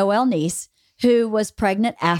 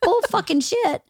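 indignant; 10 semitones higher than usual. Retold or imitated. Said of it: "and you're like".

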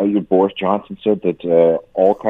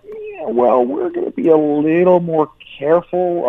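high, hesitant put-on voice; mock caution; 11 semitones higher than usual. Canada answering American full steam ahead, mock caution. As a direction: imitate someone hedging, stretched and high.

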